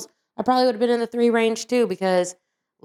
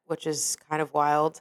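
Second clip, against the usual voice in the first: ordinary talk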